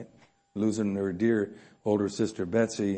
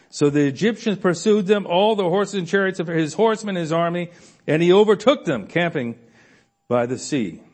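louder, higher preaching build; climbing sermon intensity; rising preaching; 6 semitones higher, 6 dB louder than usual. Acted. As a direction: drive the chase home from the pulpit. Preaching the Exodus pursuit.

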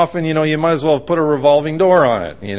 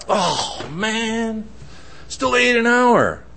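acted higher-pitched complaint; exasperated moan; 6 semitones higher, 5 dB quieter, slower than usual. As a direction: groan about the delayed plane. Traveller moaning about delay.

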